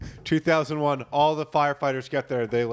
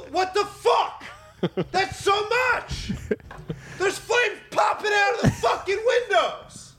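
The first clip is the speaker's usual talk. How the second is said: very high, drawn-out yelling; mock screaming; slower than usual. Designated yeller berating the fire, screaming in mock outrage. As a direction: scream at the blaze as if it can hear. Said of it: "They'd be screaming".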